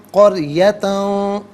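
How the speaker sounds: قریتن slow, the final noon hummed through the nose; nasal ikhfa. Shown as a demonstration. Teacher demonstrates ikhfa on قریتن, slow nasal hum. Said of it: "ناک میں آواز لے جا کر گنگنانا".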